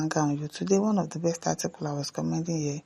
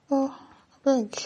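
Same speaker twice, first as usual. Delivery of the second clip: exasperated plea; 9 semitones higher, much slower than usual. Real, not acted. Exasperated aside before next comment.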